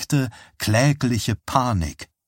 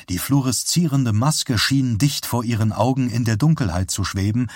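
ordinary delivery

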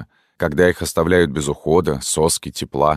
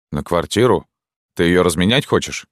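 ordinary delivery, nothing marked

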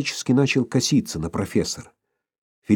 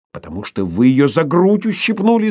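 higher, raised shout; 5 semitones higher, 4 dB louder than usual. A narrator's reading. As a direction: yell back the accusation. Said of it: "закричал Борменталь".

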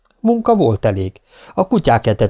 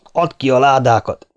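mildly angry order, slightly raised; heated demand; 4 semitones higher, 3 dB louder than usual. A narrator's reading. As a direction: order it with some temper. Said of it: "vágott közbe Green indulatosan". (indulatos követelés)

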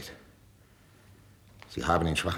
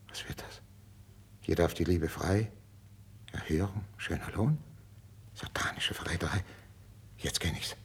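hushed, dawning suspicion; half-whispered, with pauses; murmured reading; 3 semitones lower, 9 dB quieter than usual. Acted. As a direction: read it quietly to yourself. King reads secret letter to himself.

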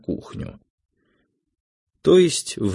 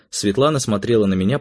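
plain reading voice

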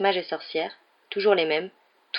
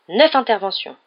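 louder, higher burst of exclamation; exclaiming; 4 semitones higher, 7 dB louder than usual. A narrator's reading. Father recounting his night at work, exclaiming. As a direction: exclaim it with raised voice. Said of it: "s'exclama monsieur Weasley".

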